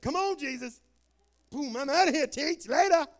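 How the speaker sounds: high, jaunty mock-teen farewell; imitated excited teenager. Preacher acting a teen raptured mid math test, gleeful. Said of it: "Some of you teenagers be like".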